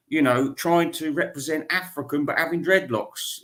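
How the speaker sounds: ordinary talk, nothing marked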